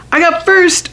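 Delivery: high, eager holler. A narrator's reading. Junie B hollers at Grace to go first. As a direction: shout it to claim first turn. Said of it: "I hollered".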